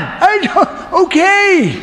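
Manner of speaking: high, sheepish squeak; sheepish Abraham. Acted. Abraham answers God's rebuke in a high sheepish squeak. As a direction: stammer it out caught.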